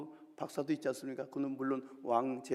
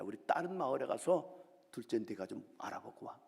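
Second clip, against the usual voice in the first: ordinary talk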